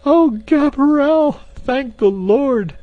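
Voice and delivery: very high, slow maid voice; relieved cry. A narrator's reading. Maid opens her eyes to Clayton, high relieved cry. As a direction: cry out thanks to the Lord.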